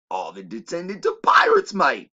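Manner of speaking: put-on loud, high British pirate accent; hammed-up British "mate"; mock British accent.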